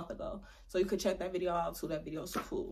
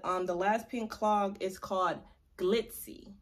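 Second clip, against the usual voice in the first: ordinary talk, nothing marked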